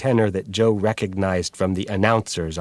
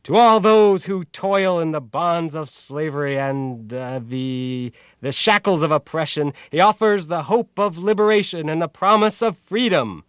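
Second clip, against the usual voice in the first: higher, declaiming radio tenor; radio announcer voice; 7 semitones higher than usual. Acted. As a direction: proclaim it like a thirties radio announcer. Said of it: "resumed his pacing and radio announcer tone".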